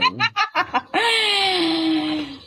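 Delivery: long high squealing laugh; shrieking laugh. Real, not acted. Reacting to the other streamer appearing, shrieking laugh. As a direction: burst out in a drawn-out cackle. Laughs as rival shows herself.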